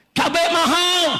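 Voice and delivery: high-pitched cry of the people; protesters' shout. Acted. People complain to officials about prices, shouted high. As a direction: call it out like an angry crowd's complaint. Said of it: "Rakyat teriak".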